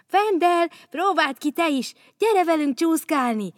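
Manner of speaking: high, loud call across the ice. Acted. Bogyó calling Vendel onto the ice, loud and high. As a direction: shout to a friend to come and play. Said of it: "hívta barátját Bogyó".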